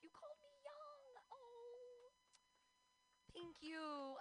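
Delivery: high, squeaky delighted thanks; hammed-up flattered gush; giddy flattered squeal. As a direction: squeal it like you've been complimented.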